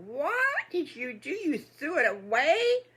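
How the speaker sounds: high, loud, slow disbelief; shocked piglets. Acted. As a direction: stretch out the appalled question. Piglets shocked pie was thrown away.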